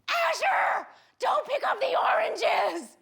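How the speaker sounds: high, loud scolding shout; sister yelling. Retold or imitated. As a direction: yell at a small child like an alarmed, angry parent. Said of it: "she's always yelling at her kid".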